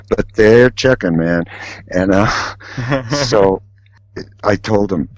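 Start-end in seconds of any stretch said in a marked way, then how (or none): none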